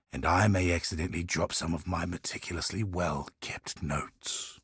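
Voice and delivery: hushed, secretive whisper; conspiratorial whisper. Acted. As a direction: lean in and whisper the offer like a bribe. Offering secrets for tips.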